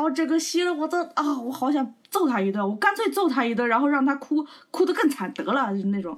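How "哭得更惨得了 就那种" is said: ordinary delivery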